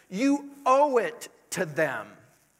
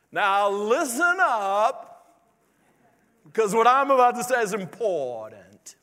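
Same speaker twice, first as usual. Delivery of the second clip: Charles Stanley impression; 8 dB louder than usual. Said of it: "Yeah, he talks like that".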